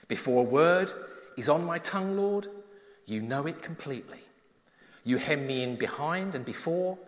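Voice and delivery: measured, reverent psalm reading; scripture reading. Acted. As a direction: read the psalm to the congregation. Reads Psalm 139 aloud.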